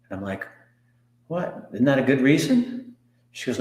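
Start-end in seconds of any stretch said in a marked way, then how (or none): 1.3-3.0 higher-pitched, taken-aback retort; puzzled protest. Retold or imitated. Client answering business coach who keeps asking why, puzzled protest. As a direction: push back in mild disbelief, pitched up.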